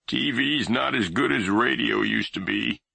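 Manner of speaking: lightly voiced grumpy old man; grumbling grandfather. A narrator's reading.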